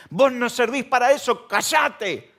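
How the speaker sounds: high loud scolding crowd voice; mock scolding. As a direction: snap at the blind beggar to shut up. Crowd silencing Bartimeo.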